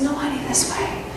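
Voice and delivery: lovingly steer them back; soft tender correction. Acted.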